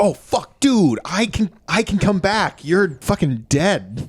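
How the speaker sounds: high, strained disbelief; high shocked voice; high dismayed Jesus. Acted. Jesus dismayed at guy's death.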